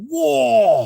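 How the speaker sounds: very high, delighted "Whoa!"; a soaring gasp of wonder; amazed whoa. Retold or imitated. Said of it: "I was like".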